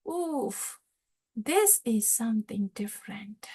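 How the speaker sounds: hushed, breathy aside of wonder; an awed inner whisper. Retold or imitated. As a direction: whisper it, struck with awe. Uddhava awed inner realization.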